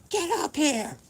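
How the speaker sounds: high, stern mom shout up the stairs; angry mom. Retold or imitated. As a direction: call him up like a furious mother. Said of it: "So my mom".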